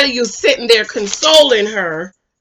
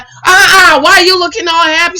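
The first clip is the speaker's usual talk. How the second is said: jealous; 7 semitones higher, 6 dB louder than usual. Retold or imitated. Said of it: "and he's like".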